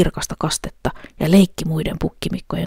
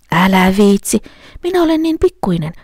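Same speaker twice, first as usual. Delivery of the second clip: high, louder goat plea; middle goat pleading; 4 semitones higher, 5 dB louder than usual. A narrator's reading. Middle goat begging the troll, high plea. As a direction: beg off sweetly as the goat. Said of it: "sanoi pukki".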